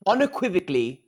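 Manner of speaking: pompously British, syllable by syllable; British accent. Pretend. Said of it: "talk in a British accent".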